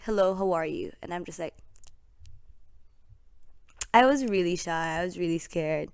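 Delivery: usual speaking voice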